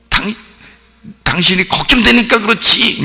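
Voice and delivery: high-pitched, forceful acted cry; worried outburst. Wife to baffled husband, high worried outburst. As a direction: burst out with worry, voice pitched high. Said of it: "더 부드럽게".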